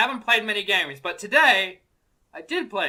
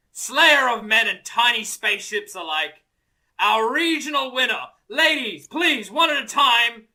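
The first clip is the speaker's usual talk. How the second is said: professional herald; 2 semitones higher than usual. Acted. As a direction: announce him like a prize fighter. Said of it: "you missed your call as a professional herald".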